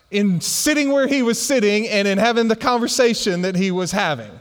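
ordinary talk, nothing marked

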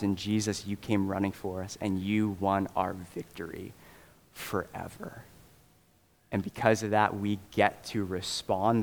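low, soft, unhurried prayer. Real, not acted. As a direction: pray quietly and earnestly to God. Leads a prayer.

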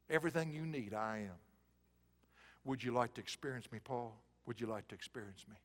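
God inviting Paul, hushed and slow; God's tender voice. Acted. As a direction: speak as God close to Paul.

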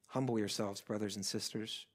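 very soft, low pastoral plea; hushed appeal. Real, not acted. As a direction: drop right down and urge them gently. Closing tender appeal to congregation.

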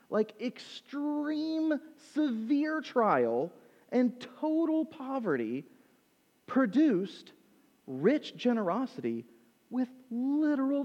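high, slow, heartfelt preaching voice; impassioned preaching. Acted. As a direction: lift it up and slow down, pleading with the church. Peak of emotional preaching.

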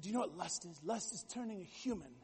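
a soft, lightly put-on British accent. Son retelling his Shakespeare professor's lust definition, light British accent. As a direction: quote the professor with a hint of British. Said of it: "He had this British accent".

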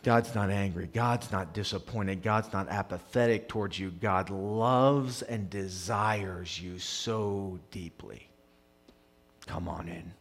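soft, low, earnest comfort; tender reassurance. Real, not acted. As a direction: let it sink in quietly. Tender assurance after impassioned peak.

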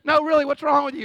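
acted exasperated demand; insistent questioning. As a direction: press the crier, loudly. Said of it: "You're like".

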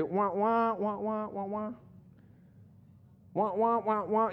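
muffled trombone-like wah wah; Charlie Brown teacher wah. Retold or imitated. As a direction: mimic the muted trumpet teacher. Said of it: "the teacher would say".

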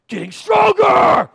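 high, drawn-out, straining shout; mock gym hype. Preacher mimicking someone relishing hardship, mock pumped. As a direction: roar it like the last rep, hammed up.

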